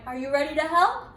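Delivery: plain reading voice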